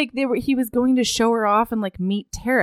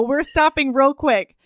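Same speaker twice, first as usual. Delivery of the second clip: ordinary talk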